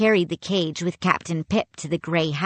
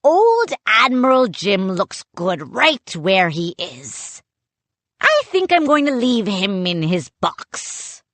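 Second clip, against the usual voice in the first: loud gloating pirate; gloating pirate captain; 2 semitones higher, 4 dB louder than usual. A narrator's reading. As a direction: savor the cruelty.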